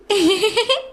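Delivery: high mocking laugh. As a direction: laugh at the dunce. Teacher laughs at student.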